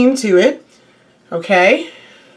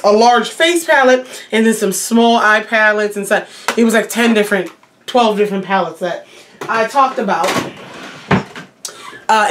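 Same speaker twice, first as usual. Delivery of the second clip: ordinary delivery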